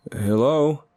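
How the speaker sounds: loud, high questioning call. A narrator's reading. Man waking in empty house, calling out. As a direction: call out to see who answers.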